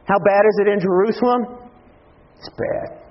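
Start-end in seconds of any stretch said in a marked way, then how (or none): 2.4-3.0 breathy whisper, barely audible. Acted. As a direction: hush it like a grim secret. Answering own question, hushed.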